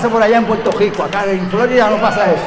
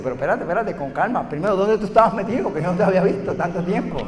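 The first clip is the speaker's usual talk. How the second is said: quiet calm questioning; 4 semitones lower, 8 dB quieter, faster than usual. Acted. Calm reply, then questioning.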